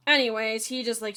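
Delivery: ordinary delivery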